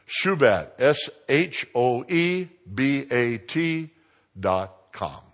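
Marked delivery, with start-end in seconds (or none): 0.8-3.9 letters spelled out slowly; slow spelling. Shown as a demonstration. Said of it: "spells the name for listeners".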